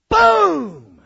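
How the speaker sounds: a huge shouted boom of fire; explosive boom. Acted. Preacher shouting the fire falling from heaven, huge boom. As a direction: blast it out like fire hitting the altar.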